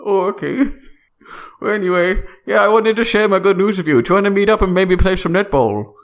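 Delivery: hammed-up Canadian friend greeting; Canadian accent. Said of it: "This is perfect Canadian accents".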